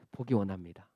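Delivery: very soft, low reply; humble answer. Acted. Blind man answers Jesus humbly.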